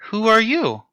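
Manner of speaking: high-pitched, sweetly curious woman's voice; sweet young woman. Acted. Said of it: "just a soft, sweet voice".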